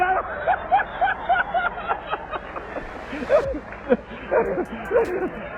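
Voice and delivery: long high-pitched laughter. Real, not acted. Laughs at own story.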